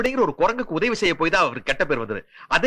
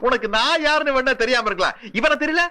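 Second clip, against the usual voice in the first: usual speaking voice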